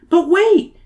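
high, loud plea; pleading. Acted. Emily begging the teacher to listen, pleading. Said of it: "Emily pleads".

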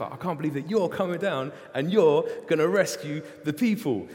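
can't believe the good news, bubbling over; excited Moses. Retold or imitated. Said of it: "He's like".

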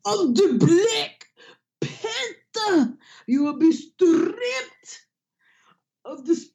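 slow, high, hammed-up African accent; mock heavy African accent. Mocking Forrest Whitaker's heavy African accent, slow and high. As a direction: ham up a heavy African accent, dragging each word. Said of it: "His accent was stronger than everybody else's".

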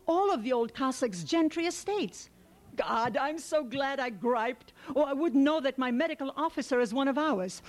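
bursting with glad surprise at meeting a neighbour; elated. A narrator's reading. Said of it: "Kuzma was elated".

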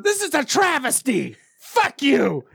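put-on high, indignant little-kid voice; mock a pouting kid stuck in the corner; sulky toddler Critter. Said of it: "I'm just imagining Critter in a corner".

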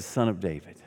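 soft, low cry for mercy; a low, hushed beggar's plea for mercy; quiet plea. Acted. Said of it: "he said".

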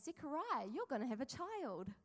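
high, playfully voiced angel delivering news; Gabriel's playful announcement. Angel telling Zechariah about a child, playful put-on tone. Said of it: "Probably didn't say it in that tone".

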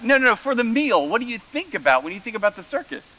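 imitating mom. Son mimicking mom correcting him. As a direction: rush it like an excited mother. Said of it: "she was like".

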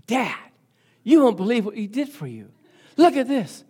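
bursting to show Dad the surprise; retold boyish excitement; happy, excited son. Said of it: "he's so happy".

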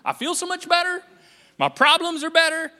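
high mock-cheery sarcasm; a lightly hammed fake relief; sarcastic relief. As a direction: gush it with obvious sarcasm. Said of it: "I suddenly go".

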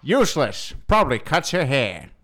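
mock transatlantic grumbling old-fashioned scold; C S Lewis. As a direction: rail in a put-on transatlantic accent. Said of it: "C S Lewis".

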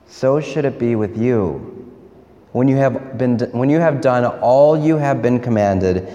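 measured, solemn liturgical reading; Gospel reading. Acted. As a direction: read the Gospel aloud to the congregation.

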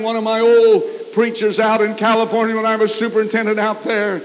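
normal talking voice

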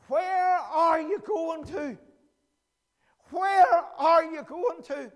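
slow, loud and high, drawn out; booming. Acted. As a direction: call it out like a summons. God's greater question.

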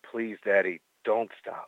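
low, soft, teasing sultry voice. Pretend. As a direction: drop it low and seductive. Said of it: "Could you do that in your sultry voice?".

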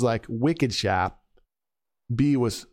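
put-on slow, drawn-out Boston accent. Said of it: "Getting good at that Boston accent".

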